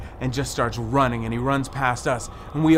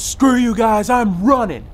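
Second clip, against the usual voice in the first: loud, high bail-out shout; panicked yell; 11 semitones higher, 11 dB louder than usual. Retold or imitated. Guy abandoning friends in fright, loud high panicked yell. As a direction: yell it as you bolt. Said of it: "And Guy goes".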